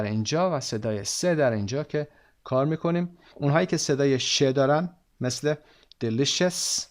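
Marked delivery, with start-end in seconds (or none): 6.0-6.8 "delicious" with "sh" in the middle: "delishes". Shown as a demonstration. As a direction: stress the "sh" inside "delicious".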